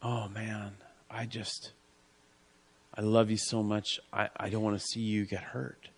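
soft and quiet, close to a whisper; hushed tender God. Pretend. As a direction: speak as God grieving over a loved child. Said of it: "God looks at you and he goes".

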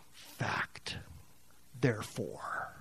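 whispered emphatic fact. Real, not acted. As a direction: breathe the word, certain. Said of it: "whispered emphasis".

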